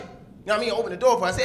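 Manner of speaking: normal talking voice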